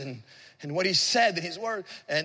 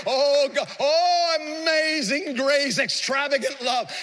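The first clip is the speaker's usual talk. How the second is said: impassioned worship; 5 semitones higher, slower than usual. Retold or imitated. Preacher recalls praying to God over his forgiven sin, building to fervent worship. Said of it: "I began to worship him and say".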